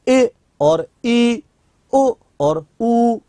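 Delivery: ई और उ धीरे, लंबा खींचकर; हर स्वर को धीरे-धीरे खींचें; खींचे हुए स्वर. Shown as a demonstration. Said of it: "बोल के देखो". (धीमे, लंबे खिंचे हुए स्वर)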